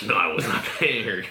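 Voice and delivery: amused protest, breaking into laughter; laughing denial. Real, not acted. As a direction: protest through real laughter.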